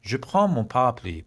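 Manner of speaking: slow, carefully spaced French; each word laid out to copy; slow model sentence. Shown as a demonstration. Teacher models the sentence for the kids, slowly.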